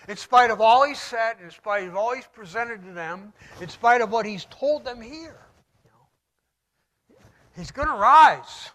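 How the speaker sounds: ordinary talk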